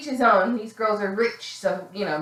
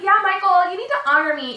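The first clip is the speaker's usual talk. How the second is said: high, loud mock scolding; imitated indignant demand; 9 semitones higher, 4 dB louder than usual. Girlfriend seconds the burnout friend, mock indignant scolding. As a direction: crow it back at him, hammed-up indignation.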